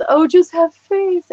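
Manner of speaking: high, slow mock-pious "just have faith"; sing-song imitation of churchy advice; mocking preachy.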